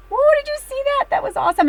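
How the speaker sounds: high, loud burst of real surprise; whoa, genuinely amazed at the card; surprised. Real, not acted. Reader startled by a card to her audience, loudly surprised. Card jumps out during reading.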